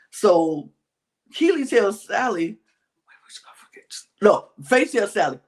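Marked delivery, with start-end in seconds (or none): 2.5-3.9 whispering. Retold or imitated. Retelling whispered plotting.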